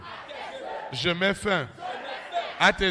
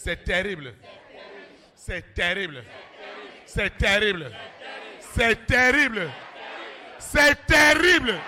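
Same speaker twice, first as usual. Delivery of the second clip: building cry; 4 semitones higher, slower than usual. Acted. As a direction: swell each repeat until you roar.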